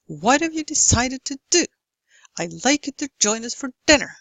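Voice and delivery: even beats on "What", "decided", "do", then "like", "join", "dinner"; regular stressed rhythm. Shown as a demonstration. Lecturer shows same time per stressed syllable, "decided" and "dinner" on the beat. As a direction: hurry "you to" and "us for" to keep the beat on "join" and "dinner". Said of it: "given number of stressed syllables".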